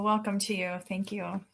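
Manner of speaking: ordinary talk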